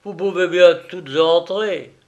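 loud, careless permission; brusque dismissal. A narrator's reading. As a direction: wave them all in with a loud retort. Master dismisses the maid brusquely. (permission lancée avec brusquerie)

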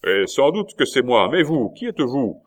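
loud, high-pitched astonishment; astonished. A narrator's reading. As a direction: call back through the door, amazed and demanding. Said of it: "La Mole exprimait son étonnement".

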